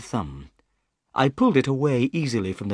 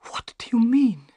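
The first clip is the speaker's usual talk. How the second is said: a scared man barely able to ask; frightened whisper; 12 semitones higher than usual. A narrator's reading. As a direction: whisper it, shaking with fear. Thaddeus shaking with fear.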